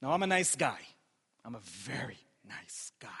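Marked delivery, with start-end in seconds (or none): 1.4-3.1 hushed, low whispered aside. Real, not acted. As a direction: whisper it slyly to the congregation. Joking hushed repeat to congregation.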